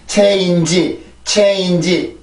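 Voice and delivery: "체인지" in evenly weighted Korean syllables; 한국식 발음. Shown as a demonstration. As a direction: say "체인지" Korean-style, full final i. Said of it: "이렇게 발음을 하지만". ("체인지"를 한국어 음절 그대로 또박또박)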